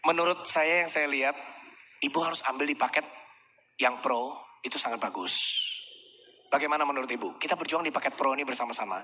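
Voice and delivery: soft guiding. Shown as a demonstration. Salesman steering a client's choice, low and soft.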